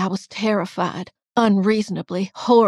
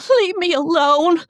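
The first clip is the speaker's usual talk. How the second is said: high, loud distraught plea; desperate, high-pitched begging; 11 semitones higher, 9 dB louder than usual. A narrator's reading. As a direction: wail to be left alone. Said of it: "I begged".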